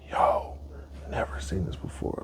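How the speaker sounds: very soft, near-whispered wonder; hushed awe. Acted. Man discovering a butterfly emerging, hushed near-whisper. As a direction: drop to a hush at a rare sight.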